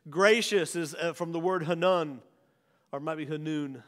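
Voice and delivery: normal talking voice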